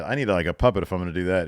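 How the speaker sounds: ordinary talk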